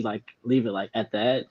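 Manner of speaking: usual speaking voice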